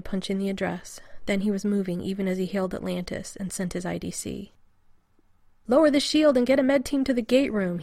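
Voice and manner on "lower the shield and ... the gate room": loud urgent shout; shouted order. A narrator's reading. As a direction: bark the order across the gate room. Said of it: "he shouted".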